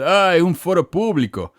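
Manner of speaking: high-pitched mimicry of an objector; mock protest. Channel owner imitating critics who claim it's public, mocking. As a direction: swoop up on a mocking "Ah" and let it fall.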